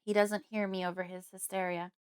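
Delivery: ordinary delivery, nothing marked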